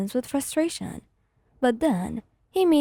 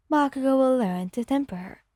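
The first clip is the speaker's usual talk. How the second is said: plain reading voice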